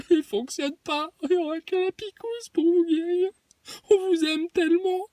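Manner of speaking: very high, syrupy sobbing whine; honeyed little voice. Retold or imitated. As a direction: coo it like a creepy sweet-talker. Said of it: "la petite voix mielleuse qu'il prend". (petite voix mielleuse et pleurnicharde)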